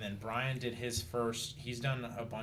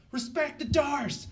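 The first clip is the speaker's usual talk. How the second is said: much higher, slow, drawn-out yell; imitating corner's shout; 8 dB louder, slower than usual. Opponent's corner yelling a warning, high drawn-out shout. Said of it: "you can hear his corner go".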